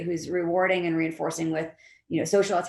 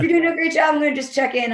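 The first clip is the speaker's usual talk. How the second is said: much higher, louder mock-cheery praise; breezy manager praise; 9 semitones higher, 5 dB louder than usual. Manager quickly praising a coder, mock cheery. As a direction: chirp it like a manager making a quick exit.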